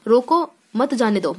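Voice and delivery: "रोको" set apart, then "मत जाने दो"; pause after रोको. Shown as a demonstration. Teacher explaining that the person must be stopped, pause after रोको. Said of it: "मतलब उस व्यक्ति को रोक लो".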